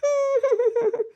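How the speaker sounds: a high, sobbing wail; high sob. Acted. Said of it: "ఏ, ఏడవకు మళ్ళా".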